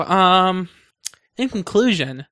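ordinary talk, nothing marked

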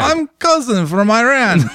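high, slow, hammed-up Iranian accent that slips toward Russian; mock Iranian accent. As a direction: introduce himself as the cousin in a jokey Iranian accent. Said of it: "voice sounds like Russian".

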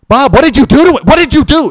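high, loud, outraged demand; shrieked accusation, hammed-up; angry godmother. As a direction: imitate a godmother yelling at her husband. Godmother furiously confronting godfather in story.

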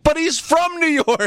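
high-pitched incredulous retort; squeaky mock outrage; mock protest. Mock protest recalled from earlier argument.

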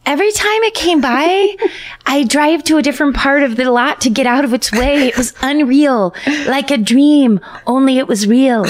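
high, airy, dazed woman's voice; high dreamlike voice. Acted. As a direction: float the words like in a trance. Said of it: "I picture her voice to be kind of high and dreamlike".

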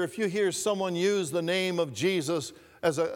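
usual speaking voice

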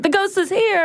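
high-pitched dramatic ghost announcement; mock alarm. Co-host parodying what a ghost video should sound like, mock alarm. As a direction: cry it out high, as if startled. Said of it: "opposite of what you're seeing".

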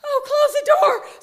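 high, loud dawning "oh"; excited echo of a command; sudden realisation. Retold or imitated. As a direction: catch on to the order all at once. Echoing the voice's order as realisation.

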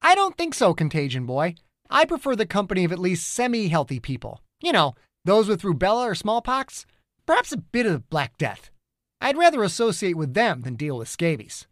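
high sarcastic teasing; mocking. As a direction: sneer it out, playfully snide. Perry mocking Bill.

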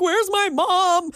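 high, drawn-out mock wail; imitated crying kid. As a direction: wail it slowly like an upset small child. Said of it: "Then he starts crying".

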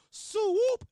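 high, loud sung-out call; high, loud "Swoop" call; crowd yell. Retold or imitated. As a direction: belt it back like a crowd. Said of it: "he wanted the crowd to yell".

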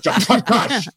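hammed-up repeated name call; mock dad calling.

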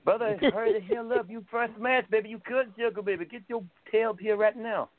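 high-pitched put-on wrestler voice; Dusty Rhodes. Working the late guy over the phone, Dusty Rhodes impression. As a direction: hustle him along in a high impersonation. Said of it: "impersonating Dusty".

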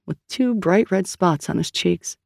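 usual speaking voice

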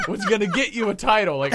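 normal talking voice

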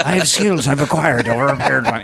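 put-on toddler as a menacing Irish action hero; deliberate threat in a put-on Irish accent; Liam Neeson. Small son boasting to dad, Liam Neeson voice. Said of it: "Liam Neeson".

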